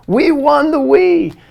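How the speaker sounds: put-on be the thrilled boy announcing the win; excited kid. Son calls dad about winning.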